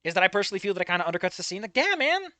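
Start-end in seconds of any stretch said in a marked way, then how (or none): none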